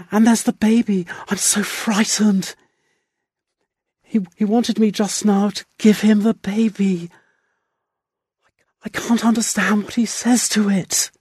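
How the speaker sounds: high, anxious woman's voice; rising pitch of helpless fear; frightened. A narrator's reading. Wife telling the doctor she is afraid of her husband, anxious.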